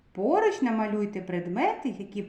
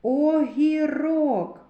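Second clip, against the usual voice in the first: Огірок stretched out slowly, each syllable drawn apart; drawn-out Огірок for a phonics lesson; slow syllable-by-syllable reading; 4 semitones higher, 4 dB louder, much slower than usual. Shown as a demonstration. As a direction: sound out Огірок slowly for children to hear every sound. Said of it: "І голосний".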